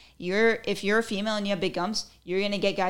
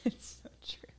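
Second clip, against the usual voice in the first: voiceless, very soft whisper; 12 dB quieter than usual. Real, not acted. Reacting to a gum meme after laughing, whispered. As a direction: whisper it under your breath.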